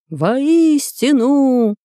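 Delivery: shouted chorus. A narrator's reading. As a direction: shout it out like warriors. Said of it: "закричали его воины".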